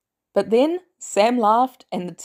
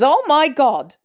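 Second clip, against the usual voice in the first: startled squeak; 6 semitones higher, 6 dB louder, slower than usual. Acted. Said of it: "Pepper squeaked".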